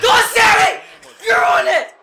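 loud, high yell of encouragement; cheering shout. Real, not acted. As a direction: cheer your fighter on at full voice. Cheering on wrestler in game.

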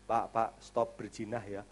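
low, soft, hesitant nudge; timid rebuke. Retold or imitated. Churchgoer timidly tells a man not to smoke, low and soft. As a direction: tiptoe into a warning.

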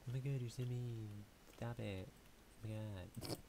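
ordinary delivery

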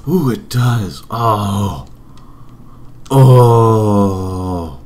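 rising awe at a lovely sight; delighted. Real, not acted. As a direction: gasp with growing delight. Delighted by the new windows.

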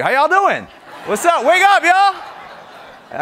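high, loud, laughing wake-up call; excited call. Real, not acted. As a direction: rouse the crowd with a laugh. Waking up a sleepy audience.

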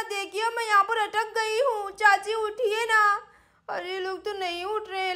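put-on babyish, lisping child voice, whimpering; little girl in trouble. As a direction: plead tearfully like a stranded small child. Voices scared dangling child Sweety. (तुतलाती छोटी बच्ची की रुआँसी गुहार)

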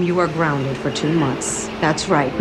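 stern grounding. Acted. Mother punishing her daughter.

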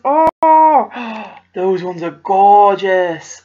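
ordinary delivery

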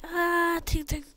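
high cry, then clinks; imitated fight. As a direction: voice fighters clashing. Imitates fighters' cries and clashing.

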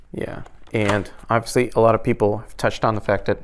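muffled through closed helmet; talking inside full-face helmet. Real, not acted. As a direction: voice behind helmet chin bar. Seen 3: Seated speaker wears full-face helmet throughout, adjusting sun visor.

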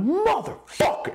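hammed-up rage shout; mock angry yell. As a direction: explode at them. The yell he held back.

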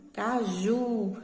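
"caju" with JU drawn out; stress on final syllable. Shown as a demonstration. Teacher showing where the strong syllable is, JU stressed in "caju". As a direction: hold the last syllable, JU, of "caju". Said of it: "a sílaba mais forte na qual foi pronunciada foi o ju".

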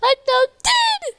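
fake-cry over a lost base; over-the-top pretend sobbing; mock wailing.

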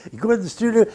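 ordinary talk

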